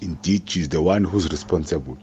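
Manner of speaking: ordinary talk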